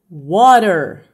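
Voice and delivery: WATER stretched out and loud; loud, drawn-out WATER with a tapped R sound for the T; slow teaching demo. Shown as a demonstration. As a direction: project WATER slowly for the class to repeat. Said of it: "Eu vou falar devagar agora".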